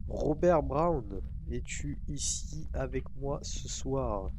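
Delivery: slow, drawn-out séance call; summoning a ghost. Pretend. Player asks the ghost if it is there, slow summoning. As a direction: call out slowly to the spirit.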